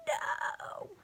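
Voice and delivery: sharp high gasped no, breathless and unvoiced; a sudden frightened gasp of a word; startled no. Real, not acted. Reader's startled reaction.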